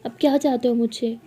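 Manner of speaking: loud, hurt outburst at family; acted angry refusal. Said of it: "ऐसे गुस्से से सबसे बात कर रही".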